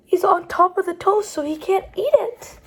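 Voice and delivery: ordinary delivery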